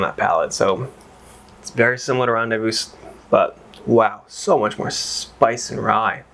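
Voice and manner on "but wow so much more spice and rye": usual speaking voice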